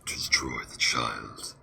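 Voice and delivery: deep, slow distorted monster roar. Acted. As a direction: growl it low and slow like a monster. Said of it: "You've never heard me do my monster voice?".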